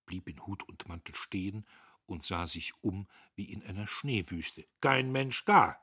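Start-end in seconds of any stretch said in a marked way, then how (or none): 4.8-5.8 langsam, laut, grimmig geknurrt; knurrend. A narrator's reading. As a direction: jedes Wort schwer und mürrisch hinsetzen. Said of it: "Der Major knurrte". (der Major knurrt über den leeren Tisch)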